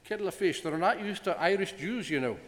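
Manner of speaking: put-on playful stage-Irish accent. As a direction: put on a jokey Irish lilt for the boy. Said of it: "My accent's not very good".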